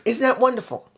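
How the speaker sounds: high-pitched mock delight; sarcastic. Real, not acted. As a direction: pretend to marvel, with a sarcastic edge. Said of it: "I'm being a little sarcastic".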